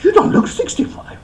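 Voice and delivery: high, teasing flattery with a laugh; butter up the old man, grinning; flattering guest. Acted. Guest teasing the birthday Master about his age, high and laughing.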